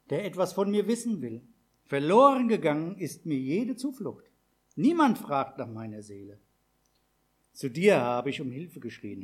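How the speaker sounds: loud, fervent scripture read, rising with the cries for help; solemn psalm reading. Acted. Preacher reads the psalm to the congregation, loud and fervent. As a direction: pray the psalm aloud as if pleading.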